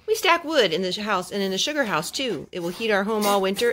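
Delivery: usual speaking voice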